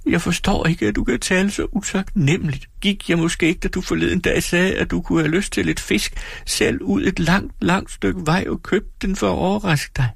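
meget høj, såret pigestemme; såret bebrejdelse. Acted. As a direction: kast bebrejdelsen ud grædefærdigt. Dora forsvarer sig grædende. (Dora anklager sin mand, højt og såret)

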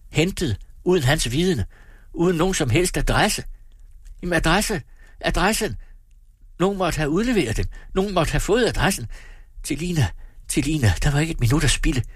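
high, agitated alarm; rising panic. A narrator's reading. Man realising the suitcases were fetched, panicked. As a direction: thoughts racing as the truth hits.

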